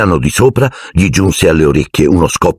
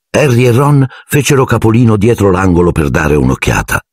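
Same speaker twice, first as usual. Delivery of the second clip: ordinary talk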